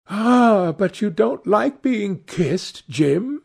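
put-on high, drawn-out girlish exclamation; affectionate cry. A narrator's reading. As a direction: cry out high, teasing a brother. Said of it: "she cried".

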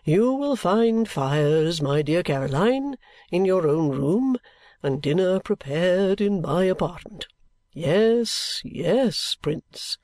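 slow, grandiose, swelling oratory; theatrical patriarch bestowing his sacrifice; pompous old father. A narrator's reading. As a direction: draw out every word with self-important solemnity.

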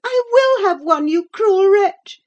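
put-on high, loud girlish outburst; screamed. A narrator's reading. Girl grabbing letters from the fire, screaming. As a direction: cry out shrilly in desperate fury. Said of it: "she screamed".

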